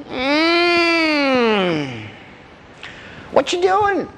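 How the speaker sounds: put-on high-pitched old-timer; hum, then ask in a high old granddad voice; old man. Old man prying into what the hunters do, high old-timer voice.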